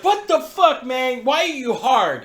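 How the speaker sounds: loud, high startled retelling; shocked yell. Retold or imitated. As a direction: relive waking up yelling in shock. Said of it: "the first thing I said".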